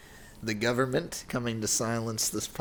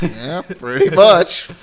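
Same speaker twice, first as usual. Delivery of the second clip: laughing; 7 semitones higher, 15 dB louder than usual. Real, not acted.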